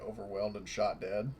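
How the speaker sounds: ordinary talk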